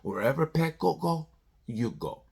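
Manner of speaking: a hint of a hushed mob heavy; mob enforcer. Riders guarding Pecco, mock mobster quietly. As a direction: quietly lay down the rules like a wise guy. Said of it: "he's like the mob boss".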